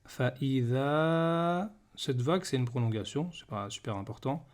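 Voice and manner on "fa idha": very slow, stretched "Fa idha"; Quran recitation. Shown as a demonstration. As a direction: hold the final long vowel. Recites the verse.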